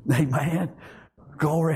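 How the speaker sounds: ordinary talk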